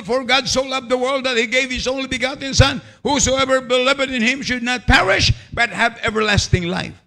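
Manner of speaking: solemn scripture quote; scripture reading. Acted. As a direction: proclaim the verse like a preacher. Preacher quoting scripture.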